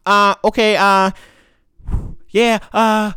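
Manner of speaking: imitating a hesitant, stuttering caller; halting string of filler sounds with gaps. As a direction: stumble along unsure, mimicking her. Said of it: "She's stuttering".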